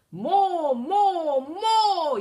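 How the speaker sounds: high, loud dragon yell. A narrator's reading. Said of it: "yelled the dragon".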